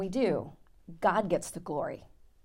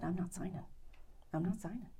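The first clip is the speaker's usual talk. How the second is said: quiet refusal; 13 dB quieter, faster than usual. Retold or imitated. Refusing to sign the contract.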